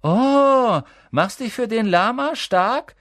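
high, loud, drawn-out taunt; loud schoolgirl taunting; mocking jeer. A narrator's reading. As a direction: jeer at them in a high, dragged-out voice. Classmate jeers at Parvati.

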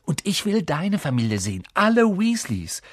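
put-on high-pitched, excited boy; climbing high with keen enthusiasm; eager excitement. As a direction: burst out with boyish eagerness. Boy eager to meet friend's family.

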